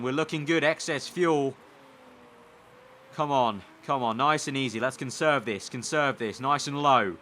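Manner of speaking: normal talking voice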